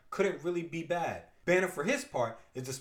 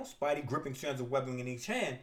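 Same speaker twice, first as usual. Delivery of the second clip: normal talking voice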